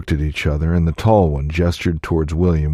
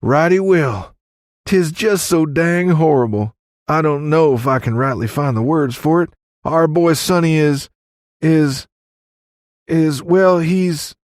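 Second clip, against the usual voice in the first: very high, overwrought country voice; distraught Johnny; 12 semitones higher, 4 dB louder than usual. A narrator's reading. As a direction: falter toward the bad news, high-pitched. Johnny struggles to report death.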